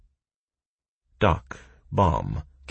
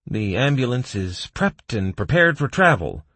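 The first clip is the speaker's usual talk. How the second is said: informing; 7 semitones higher, 4 dB louder than usual. A narrator's reading. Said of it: "Doc informed me".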